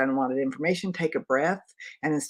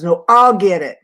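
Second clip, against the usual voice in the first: louder, higher insistent retort; retold loud retort; 4 semitones higher, 12 dB louder than usual. The nurse insisting to the daughter, loudly. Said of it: "And she goes".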